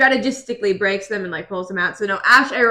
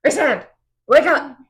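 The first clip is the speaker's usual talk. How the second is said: higher, louder urgent pleas; urgent call; 6 semitones higher, 3 dB louder than usual. Retold or imitated. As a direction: call him awake with urgency. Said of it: "she's like".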